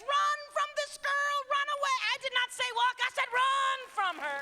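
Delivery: shouting run from her; urgent shout. Real, not acted. Urgent warning to boys.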